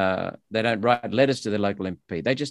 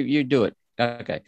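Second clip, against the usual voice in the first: ordinary talk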